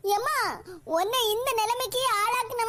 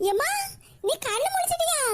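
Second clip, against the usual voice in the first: higher, eager call to the waking mother; 7 semitones higher than usual. Acted. Daughter calling her just-woken mother, eager and higher. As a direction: lean in, hopeful and relieved.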